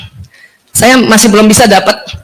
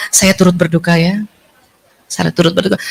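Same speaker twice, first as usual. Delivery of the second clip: softer, lower words of sympathy; soft condolence; 6 semitones lower, 6 dB quieter than usual. Shown as a demonstration. Teacher showing how to comfort the grieving, softly. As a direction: say it gently and low. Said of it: "kita paling cocok ngomongnya apa".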